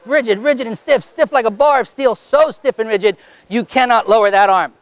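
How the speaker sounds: rhythmic, insistent repeated commands; stage hypnotist pressing the arm rigid; hypnotic suggestion. Acted. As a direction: chant the suggestion to lock the arm.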